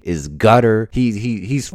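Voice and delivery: usual speaking voice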